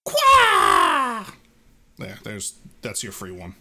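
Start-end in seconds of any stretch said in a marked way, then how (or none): 0.1-1.3 much higher, loud squeal; loud high creature shout; loud creature cry. Acted. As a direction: shriek like a tiny water Pokemon. Mudkip cry.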